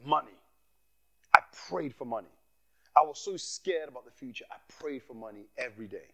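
ordinary delivery

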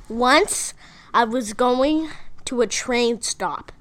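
slow, drawn-out joke setup; deliberate storytelling. Real, not acted. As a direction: tell it slowly, word by word. Starting a joke story on mic.

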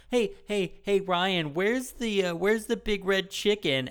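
put-on high-pitched, pestering kids' show host; mock Dora questioning. Host mimics Dora asking him to find the chicken, high-pitched mockery.

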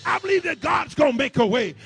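loud, rising preacher's call; climbing sermon fire; full-force preaching. Acted. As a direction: build the crowd up and shout it. Preaching climax over music.

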